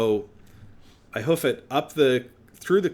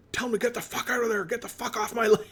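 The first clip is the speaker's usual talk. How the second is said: high, fast mock rant from the phone; imitating furious boss; 12 semitones higher, faster than usual. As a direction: play the enraged landowner, hammed up. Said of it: "he was seeing red".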